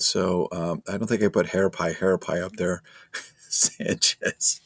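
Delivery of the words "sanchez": warm giggling at name; kind gentle laugh. Real, not acted. Laughing at chat.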